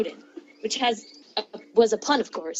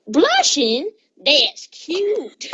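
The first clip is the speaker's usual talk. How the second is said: higher teasing voice with a laugh; teasing skeleton; 7 semitones higher, 3 dB louder, much slower than usual. Acted. Skeleton teasing the blushing reader, higher and amused. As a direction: tease her, amused. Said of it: "He notices and says".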